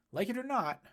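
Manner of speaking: a lightly voiced caretaker cutting in; Troy, firm. A narrator's reading. Troy answering Ray.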